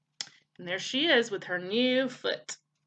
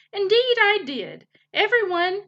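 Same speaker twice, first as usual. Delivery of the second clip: high, loud and hearty; hearty announcement; 10 semitones higher, 7 dB louder than usual. A narrator's reading. Mister Beaver welcomes the woodpecker before everyone, heartily announcing. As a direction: call out proudly to the whole group.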